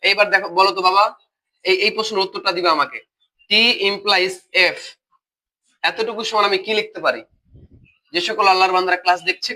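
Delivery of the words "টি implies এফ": টি implies এফ read very slowly, each symbol apart; slow spelled-out reading. Shown as a demonstration. Teacher dictating to online class, very slow. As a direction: dictate টি implies এফ for students to copy. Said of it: "teacher reads expression slowly".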